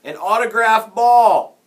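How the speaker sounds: loud, high, slow holler; kid's yell. A narrator's reading. Another kid begs the bat boy, yelling. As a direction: yell a demand from the stands. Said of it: "another kid yelled".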